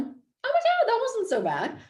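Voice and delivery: high surprised squeal of relief; mock surprised relief.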